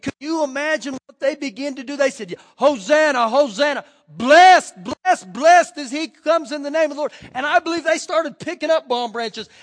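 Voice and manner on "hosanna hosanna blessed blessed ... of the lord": very loud ringing shouts; shouted hosanna. Acted. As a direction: shout it like a crowd at the gate. Crowd shouting praise at Jesus.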